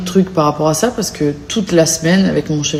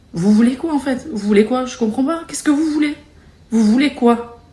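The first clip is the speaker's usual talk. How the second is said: higher-pitched, pleading exasperation; exasperated; 6 semitones higher than usual. Real, not acted. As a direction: demand an answer, pitch lifted. Exasperated questions to online critics. (exaspérée, voix plus aiguë)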